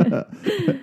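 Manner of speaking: high hammy villain laugh; evil chuckle. Acted. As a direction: cackle like a cartoon villain. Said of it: "that evil villain laugh".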